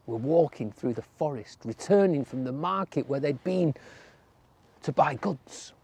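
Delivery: plain reading voice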